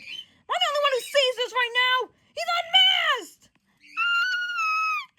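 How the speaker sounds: high shout climbing into a scream; obsessed outburst. Acted. Johnny obsessed with Peter's unmasked statue, frantic climbing shout. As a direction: build from loud disbelief to a shriek. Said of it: "he's, like, obsessed with him".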